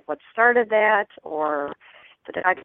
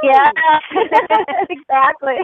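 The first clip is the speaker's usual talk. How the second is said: gushing shopping channel caller; 8 semitones higher, 6 dB louder, much slower than usual. Real, not acted. Caller reacts to the host's cheer.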